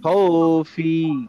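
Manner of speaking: "খৌফি" very slow, glide held; light lin stretch. Shown as a demonstration. Teacher reading for students to follow, "খৌফি" stretched. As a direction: draw out both syllables of "খৌফি". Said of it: "একটু হালকা লিন হয়ে যেন উচ্চারণ করি".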